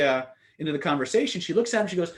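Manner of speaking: usual speaking voice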